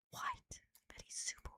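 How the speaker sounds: hushed, teasing whisper; whispered aside. Real, not acted. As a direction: whisper it like a secret jab. Teasing aside about a friend's claim.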